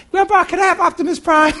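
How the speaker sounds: put-on high, sweet child begging a favor; little boy asking. Grandson asking grandpa for a toy, high and sweet. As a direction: ask like a hopeful four-year-old.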